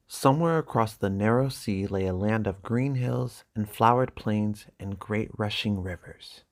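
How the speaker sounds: plain reading voice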